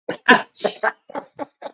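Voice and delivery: airy high-pitched laugh; high breathy laugh. Real, not acted. Host laughs at sharp brim.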